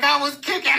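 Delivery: high, indignant little voice; mock whine; whiny kid protest. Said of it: "I'm like".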